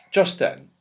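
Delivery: ordinary delivery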